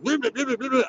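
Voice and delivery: high, mocking babble; mocking blah blah. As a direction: ape her as mindless chatter. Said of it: "She's just".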